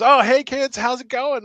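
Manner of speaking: high, upbeat hello; friendly greeting to kids. Acted. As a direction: greet small children cheerily. Kale greets little kids he knows.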